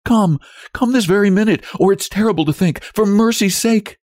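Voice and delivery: very high, desperate plea; frantic wife's entreaty; swallowing tears. A narrator's reading. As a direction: beg urgently, voice pitched right up. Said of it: "gasping and swallowing her tears".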